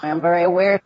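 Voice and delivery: reversed speech audio; speech run in reverse; played backwards. Real, not acted. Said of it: "she simply says, "I'm very aware".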